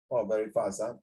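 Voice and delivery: ordinary talk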